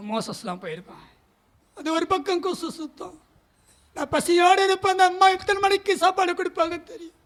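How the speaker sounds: ordinary delivery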